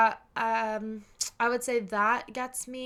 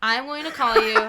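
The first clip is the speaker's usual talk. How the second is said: louder, laughing and emphatic; laughing declaration; 3 semitones higher, 8 dB louder than usual. Real, not acted. Telling friends she calls instead of FaceTiming, laughing. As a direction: say it with a laugh, decided.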